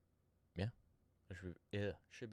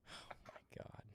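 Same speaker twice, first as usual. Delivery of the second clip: whispered; 17 dB quieter, slower than usual. Real, not acted. Laughing disbelief.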